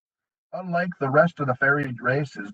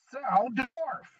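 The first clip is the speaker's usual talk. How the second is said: high, quiet sneering goblin voice; 11 semitones higher, 7 dB quieter than usual. A narrator's reading. Goblin leader sneering at the dwarf prisoner, high and nasty. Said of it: "sneered the head honcho".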